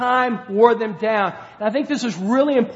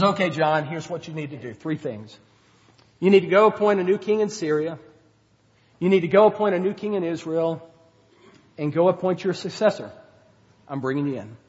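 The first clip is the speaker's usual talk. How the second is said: gentle; 4 semitones lower, faster than usual. Retold or imitated. God calmly reassuring the weary Elijah, low and gentle. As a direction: speak like a kind father settling a worried son. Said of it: "He's really gentle".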